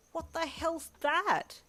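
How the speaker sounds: high, slow startled yelp; alarmed yelp, voice shooting up. A narrator's reading. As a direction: jump at the sight of it. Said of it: "you yelped".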